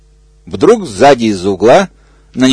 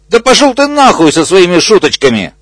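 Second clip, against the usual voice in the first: angry exclaim; 7 semitones higher than usual. Acted. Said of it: "воскликнул бегемот".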